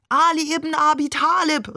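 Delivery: high, sustained chant-like cry; crowd calling out. A narrator's reading. All the other pilgrims calling to Ali, high fervent cry.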